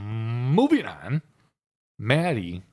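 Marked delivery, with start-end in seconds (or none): 0.0-1.2 drawn-out moving on. Real, not acted. Cutting off the chicken talk.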